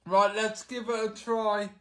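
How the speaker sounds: usual speaking voice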